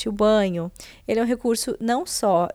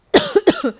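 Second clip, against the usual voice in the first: cough; 12 semitones higher, 9 dB louder than usual. Real, not acted. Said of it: "desculpa a minha tosse".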